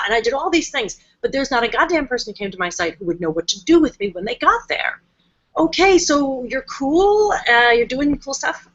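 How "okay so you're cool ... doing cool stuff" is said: higher, slow, a baffled website visitor; lightly voiced bemused stranger; puzzled visitor. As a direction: drawl it out like a lost reader. Confused site visitor's reaction.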